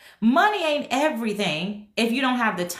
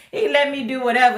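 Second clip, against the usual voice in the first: laughing; 5 semitones higher, 5 dB louder, faster than usual. Real, not acted.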